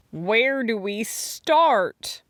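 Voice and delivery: slow, drawn-out high wail. A narrator's reading. Sarah Jane facing the sticky kitchen, wailing. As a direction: wail it in helpless dismay. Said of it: "Sarah Jane wailed".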